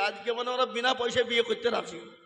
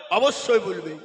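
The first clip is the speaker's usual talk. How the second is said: ordinary talk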